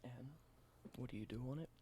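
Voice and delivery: soft, close whispered ASMR roleplay. Acted. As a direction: whisper it gently like showing an old relic. Whispered ASMR show-and-tell roleplay.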